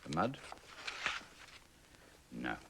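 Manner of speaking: ordinary delivery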